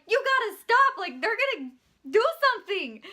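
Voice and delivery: high, loud reenacted plea; urgent warning shout. Worker warns Nancy as she kicks the cop, urgent. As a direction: shout to make her stop.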